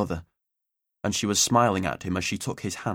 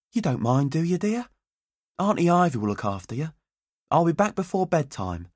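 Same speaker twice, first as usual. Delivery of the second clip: higher, lightly coaxing mum; breezy mother; 7 semitones higher than usual. A narrator's reading. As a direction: brush him off sweetly as mum.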